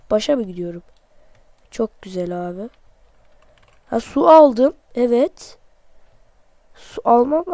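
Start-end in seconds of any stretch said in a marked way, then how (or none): none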